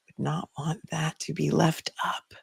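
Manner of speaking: slow, hushed whisper; murmured half to yourself, very softly; whispered aside. Real, not acted. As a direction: drop to a soft, drawn-out whisper. Quiet aside about chat comments.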